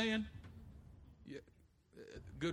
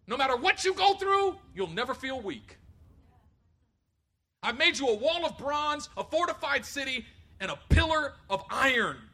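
grand promise; 6 semitones higher, 6 dB louder than usual. Retold or imitated. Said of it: "he thought God was saying".

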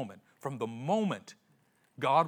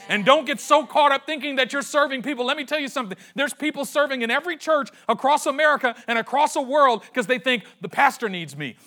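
loud, higher, driving preacher voice; full-force preaching; 7 semitones higher, 7 dB louder than usual. Acted. Pastor to congregation, preaching loud and higher at full force. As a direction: build the church up to the point.

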